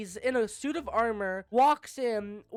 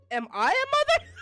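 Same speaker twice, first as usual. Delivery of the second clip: much higher, laughing; confused squeak; 13 semitones higher, 5 dB louder than usual. Acted. [name] baffled by his own question, laughing squeak.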